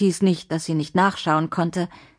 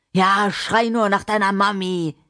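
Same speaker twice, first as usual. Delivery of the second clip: mocking, jeering man's voice; cruel taunt; 2 semitones higher than usual. Man taunting Adriana screaming for her mother, mocking. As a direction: jeer at the child's cry.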